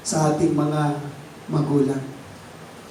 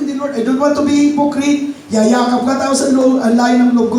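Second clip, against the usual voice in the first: high, loud protest to the Lord; loud refusal; 7 semitones higher, 5 dB louder than usual. Retold or imitated. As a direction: declare your refusal outright. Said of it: "Sabi ko". (malakas na pagtanggi sa Panginoon)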